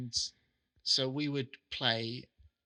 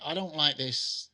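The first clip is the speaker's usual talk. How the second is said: usual speaking voice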